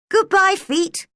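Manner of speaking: Alice calling goodbye to her feet. Acted. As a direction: call down to far-off feet. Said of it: "she called".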